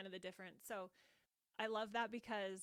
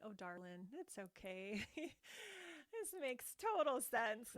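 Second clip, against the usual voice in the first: tender self-soothing; 3 semitones higher, slower than usual. Shown as a demonstration. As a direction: soothe yourself like a grandmother. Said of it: "I'll talk to myself like".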